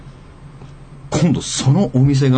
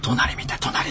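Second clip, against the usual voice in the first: softer whisper, urging; imitated whisper; 8 dB quieter, much slower than usual. Sister whispering to brother to look beside them. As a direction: whisper so the neighbor can't hear.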